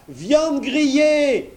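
very high, slow mocking cry; blacksmith crying out. A narrator's reading. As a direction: jeer it out, stretched. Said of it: "cria le forgeron".

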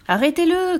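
high call at moderate volume; crying old lady. A narrator's reading. Old lady calls to stop the runaway, crying out. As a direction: call out high, without much extra force. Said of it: "cria la vieille dame".

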